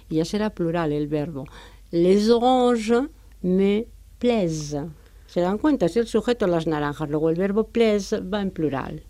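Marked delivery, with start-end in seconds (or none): 1.9-4.9 Les oranges me plaisent muy despacio; slow French example. Shown as a demonstration. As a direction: dicta la frase despacio y claro. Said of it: "tendré que decir". (ejemplo francés lento)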